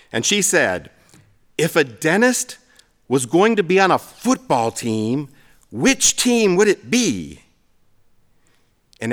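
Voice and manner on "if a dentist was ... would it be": lightly imitated mom posing a riddle; mom telling joke. Mom quizzes the family at her party, lightly voiced riddle. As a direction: lift the pitch and stretch the final question.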